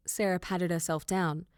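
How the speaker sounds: usual speaking voice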